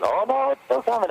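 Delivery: high, hammed-up worried announcement; mock fretful update. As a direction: squeal it like a nervous teaser post. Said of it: "And then I go with".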